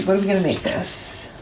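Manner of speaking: usual speaking voice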